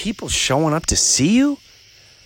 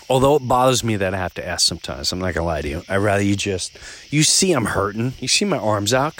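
normal talking voice

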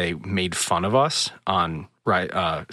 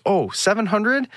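much higher, teasing question; mock impressed; 13 semitones higher than usual. Smuggler reacting to guests' score, mock impressed. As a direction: imitate someone pretending to be impressed.

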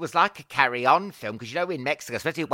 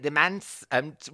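usual speaking voice

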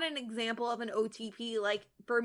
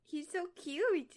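cooing; 6 semitones higher, slower than usual. Real, not acted. Cooing over a sneezing kitten.

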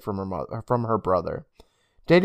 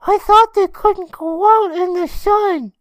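put-on loud, high, drawn-out squeal of a kid; hammed-up high child voice; high-pitched kid; 8 dB louder, slower than usual. A narrator's reading. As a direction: squeak it out slowly like a little boy. Boy's urgent line, story read aloud.

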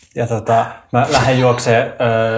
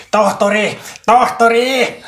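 high urgent call, rising higher on the repeat; 11 semitones higher, slower than usual. Acted. Character running toward the wounded calls for a doctor, high shout. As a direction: call for the doctor across a distance. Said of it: "Ja mä huudan kulkiessani".

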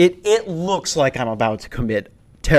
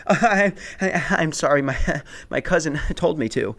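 mock nervous stammer, broken by giggles; 3 semitones higher than usual. Nervous passenger excusing himself to the guard, stammering. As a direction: stammer out a flustered apology, laughing. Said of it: "I was so nervous that I go".

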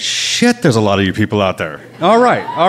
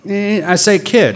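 plain reading voice

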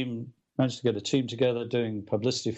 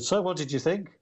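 imitated Liverpool accent, pitched up; higher-voiced Liverpudlian question; 6 semitones higher than usual. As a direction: put on a light Scouse lilt. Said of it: "that Liverpool accent is hilarious".

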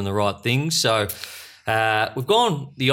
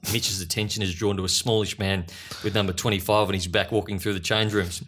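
ordinary talk, nothing marked

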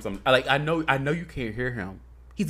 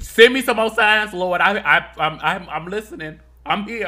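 loud, high plea to heaven; calling out; 6 semitones higher, 7 dB louder than usual. Real, not acted. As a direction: call up to the Lord. Calling out to God.

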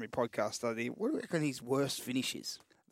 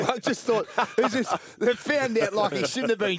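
laughing through the words, higher and louder; 7 semitones higher, 6 dB louder than usual. Real, not acted. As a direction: crack up as you tell it. Laughs at the story.

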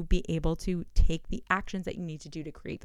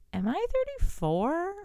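mock confusion; 6 semitones higher, 7 dB louder than usual. Confused about own age, laughing.

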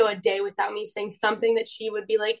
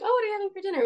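much higher, friendly and light; bright cheerful question; 9 semitones higher, much faster than usual. Retold or imitated. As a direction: ask about dinner in a sunny high voice.

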